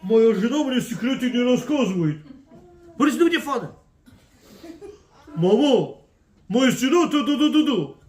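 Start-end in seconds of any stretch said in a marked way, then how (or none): 0.0-2.2 whining son. Acted. Son complaining to his father. 5.3-8.0 mock complaining child. Spouse complaining to parents.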